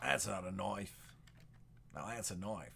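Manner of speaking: lightly voiced Aussie bushman, hushed; softly playing the outback hero showing off his knife; Crocodile Dundee line.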